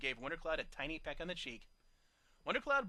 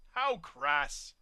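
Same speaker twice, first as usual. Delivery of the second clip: haughty scoff; disdainful; 4 semitones higher, 5 dB louder than usual. A narrator's reading. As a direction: sniff at it. Snob unimpressed.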